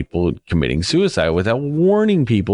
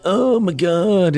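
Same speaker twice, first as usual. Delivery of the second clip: stretched very high, slow gasp of shock; imitating a horrified crowd; mock horror; 12 semitones higher, much slower than usual. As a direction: act appalled in a hammed-up way. Crowd reacting to the mallet.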